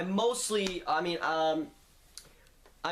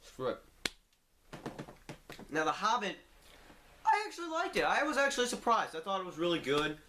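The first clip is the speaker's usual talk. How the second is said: talking through a mouthful; mouth full; 5 semitones higher, faster than usual. Real, not acted. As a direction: speak round a bite of food. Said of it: "talk with my mouth full".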